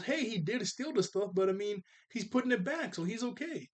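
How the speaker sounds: hint of a little girl shrugging it off; high, casual Lil; Lil's voice. Lil voiced in retold episode.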